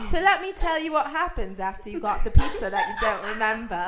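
loud, badly faked British accent; awful British accent. Telling the forgotten pizza story to a friend, awful British accent. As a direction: lay on a bad British accent, loudly. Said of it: "that accent is awful".

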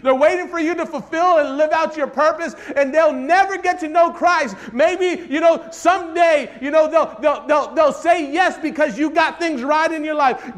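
high, loud, building sermon plea; full-force preaching. Real, not acted. Preacher pleads for those waiting on the congregation, full-force preaching. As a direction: build to a shouted appeal.

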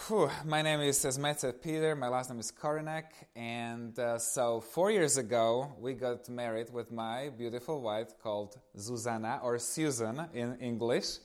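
normal talking voice

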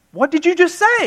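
high, indignant "what did you just say"; a kid catching mom out, voice jumping up; incredulous kid. Retold or imitated. Child calling out his mom on her own words, incredulous. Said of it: "I'm like".

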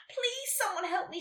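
high, thin wail at normal volume; mild wail for help; wailing plea. A narrator's reading. Nelly stuck on her letter, wailing. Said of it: "she wailed".

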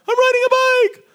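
very high squeal of delight; thrilled kid. Retold or imitated. Child whose dad lets go of the bike, squealing with delight. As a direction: squeal like a child riding alone for the first time. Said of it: "And it's like".

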